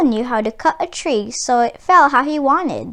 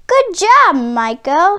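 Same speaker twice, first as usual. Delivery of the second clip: higher, louder mocking jeer; 5 semitones higher, 4 dB louder than usual. A narrator's reading. Older brother mocking Miko, louder and higher. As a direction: sneer at his little brother. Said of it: "his brother mocked".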